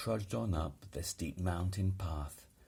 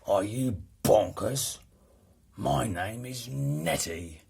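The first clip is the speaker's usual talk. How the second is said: louder, offended retort; indignant howl; 3 semitones higher, 7 dB louder than usual. A narrator's reading. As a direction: bark back, insulted. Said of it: "with a horrible howl".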